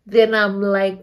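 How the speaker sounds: ordinary delivery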